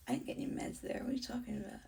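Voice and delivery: hushed, incredulous whisper; whispered retort. Retold or imitated. Whispering back at the staff about the meds. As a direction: whisper it in disbelief.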